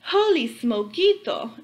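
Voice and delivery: slow, loud, high exclamation; excited exclaim. A narrator's reading. Cat sees himself in the mirror, loud slow exclaim. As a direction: burst it out in amazement. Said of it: "exclaimed Skippy John Jones".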